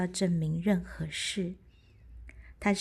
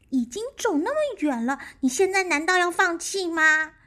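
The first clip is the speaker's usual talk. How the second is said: high little squirrel voice; small animal pleading; 9 semitones higher, 6 dB louder than usual. Acted.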